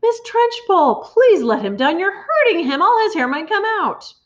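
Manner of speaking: high, pleading alarmed cry; teacher pleads from the back; cried out. A narrator's reading. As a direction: beg her to stop. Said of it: "Miss Honey cried out".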